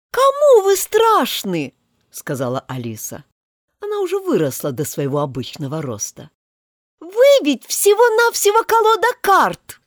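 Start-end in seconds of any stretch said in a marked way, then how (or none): 0.1-1.7 high, loud, slow scorn; defiant. Acted. Grown Alice defies the cards, loud and scornful. 7.0-9.8 defiant. Acted. Grown Alice defies the cards, loud and scornful.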